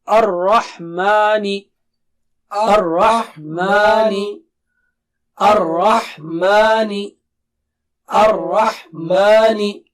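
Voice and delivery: Ar-Rahmani slow, thick ra and clear ha; slow teaching drill of Ar-Rahmani; slow drilled word. Shown as a demonstration. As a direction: recite Ar-Rahmani slowly, every sound clear. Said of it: "recite the whole word".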